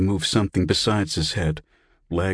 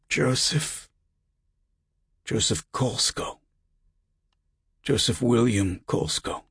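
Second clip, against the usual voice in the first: higher-pitched dry rasp; raspy; 5 semitones higher than usual. A narrator's reading. Patient correcting his name for the voice, rasping. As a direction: croak out the name through a dry throat. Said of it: "The rasp in his voice".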